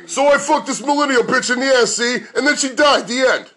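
brash dirty-joke delivery, pitched high; Andrew Dice Clay. Acted. Comic parodying a dirty stand-up act, high brash impression. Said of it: "impression of Andrew Dice Clay".

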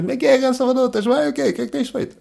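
put-on high, hearty fifty-year-old mate's hail; old buddy greeting. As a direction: greet him like an old pal met walking the dog. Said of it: "o tipo de abordagem que um gajo de cinquenta anos".

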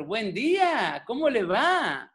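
slow, singsong mock greeting; slow, drawn-out imitation of a charming greeting; gushing neighbour. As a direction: stretch it out like an over-sweet neighbour. Neighbour greets him sweetly in elevator.

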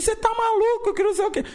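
high incredulous voice. Retold or imitated. Retold reply in disbelief.

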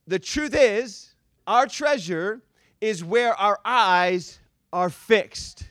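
slow, deliberate repeat with pauses between phrases; measured, weighty emphasis; emphatic repeat. Real, not acted. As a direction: drive the point home, phrase by phrase. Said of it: "Let me say that again".

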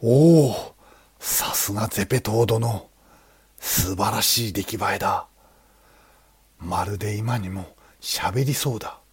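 delighted exclamation. Acted. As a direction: marvel out loud at the carving. Monk admires the statue.

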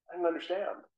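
ordinary delivery

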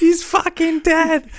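very high, slow wail; horrified squeal. Acted. Onlooker at a gruesome death, horrified squeal. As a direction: squeal it out slowly in mock shock.

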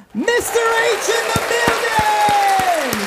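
high, loud, slow drawn-out announcement; hype announcer. Acted. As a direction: stretch it out like a ring announcer. Announcing the guest.

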